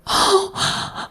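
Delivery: high breathy mock gasp of the women; hammed-up shocked gasp. Women in church gasping at the sermon, mock gasp. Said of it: "all the women were like".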